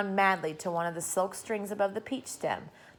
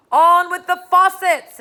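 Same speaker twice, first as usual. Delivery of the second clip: very loud, high, slow bellow from far above; booming shout; 11 semitones higher, 15 dB louder than usual. A narrator's reading. Cloud man ordering the rain, slow booming shout. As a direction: boom it down from the cloud. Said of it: "it shouted".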